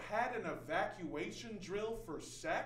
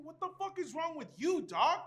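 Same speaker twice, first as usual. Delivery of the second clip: higher, punchy comeback landing hard on YOU; angry retort; 7 semitones higher, 7 dB louder than usual. Acted. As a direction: fire the question back, loud by the end. Turns the question back angrily.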